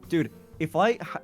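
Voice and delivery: ordinary delivery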